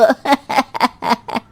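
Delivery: soft laugh falling away; acted elderly chuckle. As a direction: chuckle at your own boast. Mother-in-law laughs at own boast.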